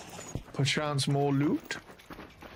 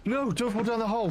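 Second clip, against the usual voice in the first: panicked; 7 semitones higher, faster than usual. Real, not acted. Panicked about falling.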